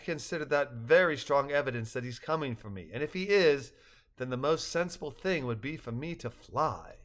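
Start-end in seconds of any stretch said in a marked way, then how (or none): none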